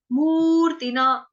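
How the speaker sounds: मूर्तिना with a long मू, slow. Shown as a demonstration. Teacher shows the long vowel in the word, slowly. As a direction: stretch मू in मूर्तिना. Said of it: "मु जो है वो दीर्घ है".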